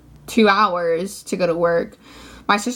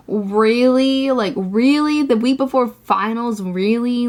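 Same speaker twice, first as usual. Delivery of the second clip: louder, higher, incredulous really; can't believe this, really; venting to viewers in indignant disbelief; exasperated disbelief; 3 semitones higher, 4 dB louder, slower than usual. Real, not acted. Venting over unfair suspension.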